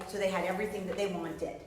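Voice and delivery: ordinary delivery, nothing marked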